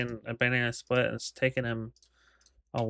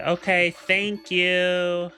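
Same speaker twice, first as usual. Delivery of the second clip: slow, louder, dripping with sarcasm; loud sarcastic thanks; 6 semitones higher, 4 dB louder, much slower than usual. Retold or imitated. Walking out on the slow server, loud sarcastic thanks. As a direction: drag it out loud and rude so he hears. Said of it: "very rudely, very sarcastically".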